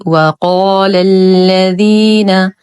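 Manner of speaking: "وقال الذين" recited slowly, full heavy ق then light ل; heavy qaf, light lam. Shown as a demonstration. Teacher shows learners heavy qaf, slow recited "وقال الذين". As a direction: thicken the qaf in "وقال", keep lam thin. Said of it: "نفخم القاف بأعلى درجات التفخيم ونخلص اللام".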